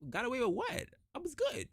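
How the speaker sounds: high-pitched baffled comeback; mock indignant disbelief. Cleared employee to tearful team, mock indignant disbelief. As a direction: throw it back high, mildly affronted.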